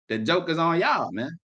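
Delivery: usual speaking voice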